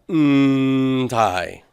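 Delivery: "ntai" with a long, loud hummed n; relished pronunciation. Shown as a demonstration. Teacher relishes saying the ending, "ntai" loud with drawn-out n. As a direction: hold the n of "ntai", then release into tai. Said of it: "I love saying that".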